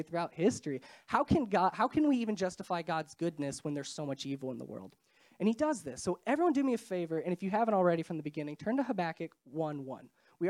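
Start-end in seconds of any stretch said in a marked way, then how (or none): none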